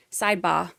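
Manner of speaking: put-on Boston accent, dropped r. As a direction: sidebar said as sidebah, Boston accent. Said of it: "my Boston accent is getting much better".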